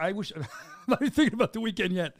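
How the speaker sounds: laughing through the words; amused laughing. Real, not acted. Joking about guest's weekend remark.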